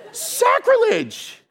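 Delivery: very high, drawn-out squawk; slow, hammed-up falsetto protest; mock shrieked outrage. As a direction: squeal it like a scandalized fan. Mimics shocked audience, higher repeat.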